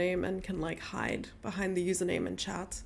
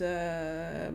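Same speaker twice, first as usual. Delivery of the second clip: normal talking voice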